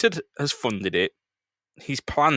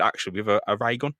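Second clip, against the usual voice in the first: usual speaking voice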